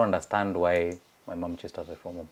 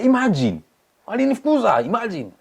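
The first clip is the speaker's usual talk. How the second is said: indignant; 13 semitones higher, 6 dB louder than usual. Retold or imitated. Indignant complaint to neighbor.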